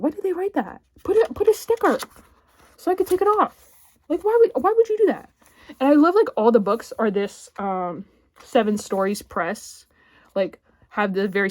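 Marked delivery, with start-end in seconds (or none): 0.0-5.3 high, indignant complaint; high outraged complaint about the cover; exasperated whine. Real, not acted. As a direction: whine at the publisher in disbelief. Annoyed at the printed award banner.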